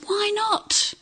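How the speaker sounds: high-pitched motherly question; lightly voiced exasperated mum; demanding mum. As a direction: act out a mum challenging her boy. Cartoon mum demanding a reason.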